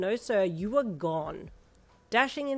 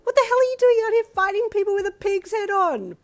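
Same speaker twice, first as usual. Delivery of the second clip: a traveller stunned by the face under the mask; screeched; 14 semitones higher, 12 dB louder than usual. A narrator's reading. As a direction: screech it in total shock. Continues screeching.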